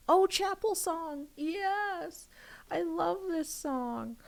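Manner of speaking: high, slow, delighted cry; light up at the song; excited. Real, not acted. Streamer spotting her favourite song, high and delighted.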